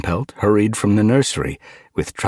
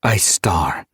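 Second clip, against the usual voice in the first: raised, ceremonial leader-naming voice; solemn proclamation; 5 semitones higher, 5 dB louder than usual. Acted. StarClan bestows a new name and nine lives on the leader, solemn proclamation.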